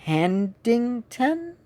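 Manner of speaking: "Handington" sounded out slowly, last syllable like "ten", rising; hesitant mispronunciation. Retold or imitated. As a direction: imitate people puzzling out "Handington" as a slow question. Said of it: "Usually people pronounce it".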